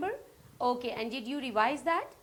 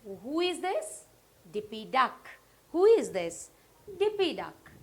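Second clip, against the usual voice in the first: put-on reading to a toddler; 4 dB louder than usual. Quiz call-and-answer to kids.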